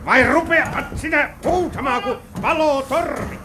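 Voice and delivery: high-pitched, worked-up threat; angry scolding. Acted. Robber threatens the screaming captive, angrily.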